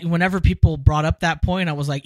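ordinary delivery

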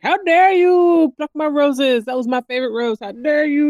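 loud, high outraged roar; mock-furious monster demanding an answer; indignant beast. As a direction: thunder it like an offended beast. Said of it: "and the beast is like".

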